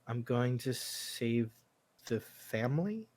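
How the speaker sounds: put-on a boy asking his father for reassurance; timid son's question; unsure boy.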